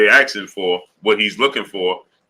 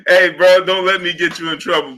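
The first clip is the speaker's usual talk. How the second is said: laughing; 9 semitones higher, 5 dB louder than usual. Real, not acted. Laughing warning to viewer.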